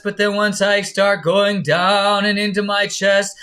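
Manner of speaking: loud, full Broadway belt; mock Broadway power; powerful chest voice. Shown as a demonstration. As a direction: sing it out big from the chest. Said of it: "I start getting this power".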